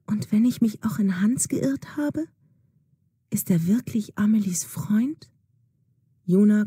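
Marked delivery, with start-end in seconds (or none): 0.0-5.3 anxious private whisper; girl doubting her friend, whispering to herself; whispered thought. Acted. Juna's anxious inner thoughts.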